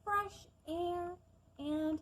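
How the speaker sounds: gentle storybook narration; calm storytime reading; reading a story aloud. Acted.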